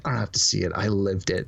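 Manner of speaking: slightly put-on low voice; cool movie line. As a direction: quote it low, like a nonchalant hero. Acting out the film's great line.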